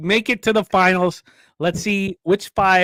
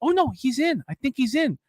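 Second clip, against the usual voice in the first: higher race-call energy; excited play-by-play; 4 semitones higher than usual. Acted. Co-host wraps up the heat results, still excited. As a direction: keep the announcer lift through the finish.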